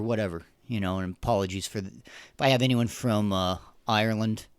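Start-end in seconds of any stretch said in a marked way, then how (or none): none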